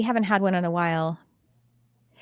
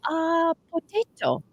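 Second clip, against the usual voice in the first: slow, high, comically drawn-out; funny voice; 8 semitones higher, slower than usual. Retold or imitated. Offering husband a cat name, in a funny way. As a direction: draw it out high and silly. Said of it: "I said it, like, in a funny way".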